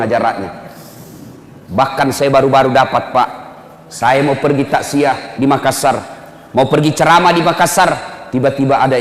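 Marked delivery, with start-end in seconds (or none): none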